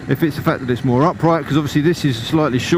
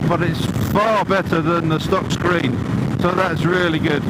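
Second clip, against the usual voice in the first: talking over wind inside a helmet; raised voice through a helmet mic at speed; 3 semitones higher than usual. Real, not acted. Riding at seventy in a helmet.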